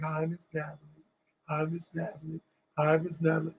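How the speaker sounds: very soft, awed hello; meek greeting. Retold or imitated. Young singers greet the star at lunch, softly and meekly. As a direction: say it small and reverent.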